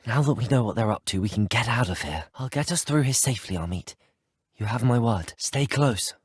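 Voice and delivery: usual speaking voice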